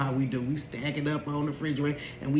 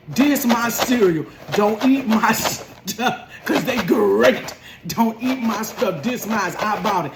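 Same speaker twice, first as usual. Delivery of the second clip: loud, slow, higher, laughing through it; son's loud announcement; 5 semitones higher, 5 dB louder, slower than usual. Retold or imitated. Son in his sister's doorway, loudly claiming cereal. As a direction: declare ownership from the doorway. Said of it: "Standing in his sister's doorway".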